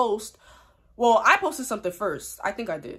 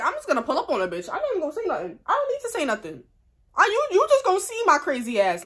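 fired-up threat; 8 semitones higher, 3 dB louder, faster than usual. Retold or imitated. Retelling her fired-up reply.

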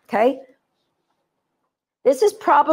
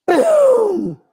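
explosive boom; 9 semitones higher, 3 dB louder than usual. Pretend. Dog launches into water.

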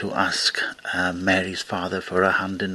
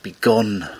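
commanding; 7 dB louder than usual. A narrator's reading. Corpse orders Teague from its grave.

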